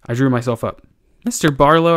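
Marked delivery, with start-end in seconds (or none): 1.2-1.9 higher, louder, stiffly formal address; haughty, raised-voice reproof. A narrator's reading. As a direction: pull rank with stiff formality. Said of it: "I said stiffly".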